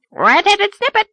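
high loud squawk full of contempt; contemptuous parrot. Acted. Parrot mocks Anne, high loud contemptuous squawk. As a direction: sneer it like a spiteful parrot. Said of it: "in a tone of profound contempt".

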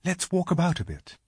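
put-on brisk young girl; Susan's voice. A narrator's reading. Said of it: "said Susan".

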